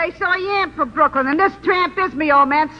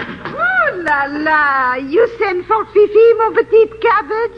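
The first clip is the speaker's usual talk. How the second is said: put-on phony French accent; 2 semitones higher than usual. Actress in her fake French accent greeting the studio boss. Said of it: "that phony French voice".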